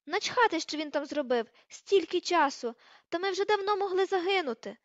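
high, loud furious tirade; shrieking anger. A narrator's reading. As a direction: rant over any objection. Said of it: "верескнула вона".

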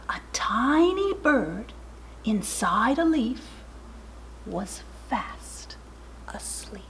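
soft, breathy, fading to near silence; hushed. A narrator's reading. Narrator shows tiny bird asleep, hushed. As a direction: let the words drift off to sleep.

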